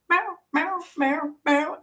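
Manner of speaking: small high plaintive mock meows; high-pitched imitation cat meows; imitating cat meow. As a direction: mimic a complaining cat, high and repeated. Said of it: "she just goes".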